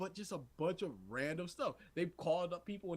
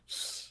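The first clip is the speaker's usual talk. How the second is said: sharp "shh" at chatterers; drawn hushing noise; shushing. Retold or imitated. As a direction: shush the people behind you. Dude in front shushes them.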